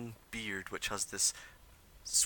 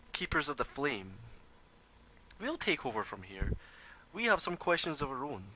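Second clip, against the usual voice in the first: smooth stranger voice; 5 semitones higher than usual. Acted. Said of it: "And he says".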